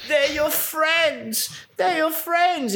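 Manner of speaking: very high, very slow, hammed-up wooden delivery; exaggerated bad acting. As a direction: overdo the terrible actor, drawing out every word. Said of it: "like, the worst acting ever".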